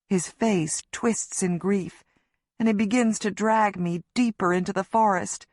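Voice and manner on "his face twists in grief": ordinary delivery, nothing marked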